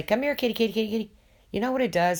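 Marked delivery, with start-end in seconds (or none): 0.0-1.1 high sing-song kitty call; coaxing kitty call. Retold or imitated. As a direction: coax the cat over, high and sweet. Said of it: "and say".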